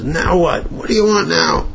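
high-pitched, fed-up questioning; retold irritated greeting; exasperated demand. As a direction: challenge the pest who came back. Retold Ziskin confronts returning messenger.

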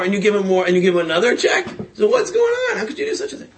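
wife blowing up at her husband in disbelief; exasperated outburst. Retold or imitated. Wife confronts husband after he leaves.

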